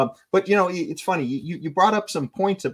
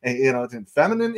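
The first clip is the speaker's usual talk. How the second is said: normal talking voice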